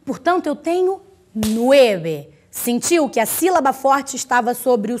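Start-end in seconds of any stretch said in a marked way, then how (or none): 1.3-2.3 "nueve" slow, with the ue drawn out; stressed diphthong. Shown as a demonstration. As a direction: lean on the NUE of "nueve". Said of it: "faz com que esse O vire o E".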